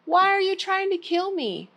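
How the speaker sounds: high, fast calling out. Acted. David calling out to the departing king. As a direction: call across to the king. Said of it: "David called out to him".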